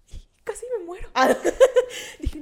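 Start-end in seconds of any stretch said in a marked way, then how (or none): 1.1-2.1 sudden loud burst of laughter. Real, not acted. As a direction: laugh at your own drama. Laughs at own drama.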